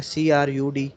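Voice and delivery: normal talking voice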